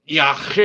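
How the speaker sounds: Yacht with a rasped "ch" as in loch; spelling pronunciation. Shown as a demonstration. Streamer guessing at an unknown word, Yacht with throaty "ch". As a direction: sound out the CH in Yacht harshly. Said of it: "tell me how you would say it".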